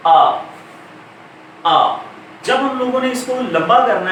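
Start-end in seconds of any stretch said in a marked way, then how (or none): 0.1-2.0 a drawn-out open आ; long aa sound. Shown as a demonstration. Teacher modelling the long vowel आ for students. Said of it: "उसके बाद है".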